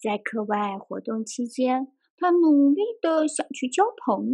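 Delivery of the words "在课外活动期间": usual speaking voice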